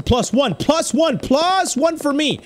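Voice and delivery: high excited cheer; triumphant, stretching the last words; gleeful celebration. Real, not acted. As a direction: crow over a point, pitch climbing. Celebrates a point.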